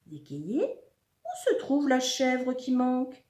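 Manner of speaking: high, slow uncle's voice; put-on voice for an inquiring uncle; questioning uncle. A narrator's reading. Oncle réclame sa chèvre manquante.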